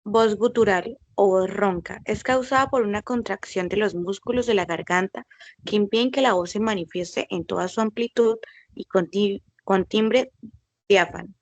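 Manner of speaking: normal talking voice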